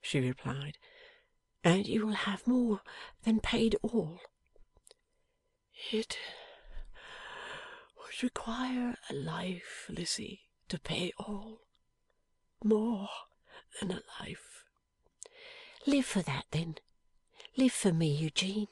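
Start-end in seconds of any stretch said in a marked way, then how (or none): 1.6-4.2 lightly voiced young wife, tender and earnest; a hint of Lizzie. A narrator's reading. Young wife answering her dying husband, gently earnest. 5.9-14.6 slow, breathless whisper of a wounded man; dying man's whisper. A narrator's reading. Wounded husband whispering to his wife, faint and slow.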